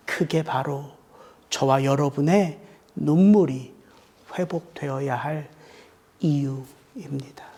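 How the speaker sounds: soft solemn final words to the congregation; solemn hush. Real, not acted. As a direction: close the sermon in a hush. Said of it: "soft solemn close of the sermon".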